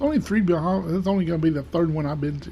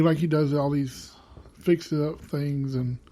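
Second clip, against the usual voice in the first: ordinary delivery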